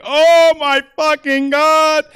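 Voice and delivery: high, slow, strained yell of pain. Retold or imitated. Comic telling his arrest story yells in mock pain. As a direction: howl it like your knee just popped out. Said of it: "writhing in pain".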